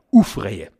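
"Ufrehe" with a long U for Au, said low. Shown as a demonstration. Showing listeners the dialect, "Ufrehe" with U for Au. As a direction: drop the voice on "Ufrehe", U in place of Au. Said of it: "Ganz oft wird das Au durch ein U ersetzt".

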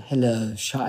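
lightly imitating a famous rich voice; beautiful deep voice.

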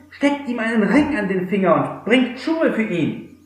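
overflowing with joy at his son's return; joyful commands. Acted. Father ordering servants to prepare the feast, joyous. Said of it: "befahl den Knechten".

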